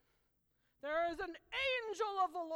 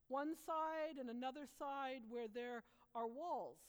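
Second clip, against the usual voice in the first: usual speaking voice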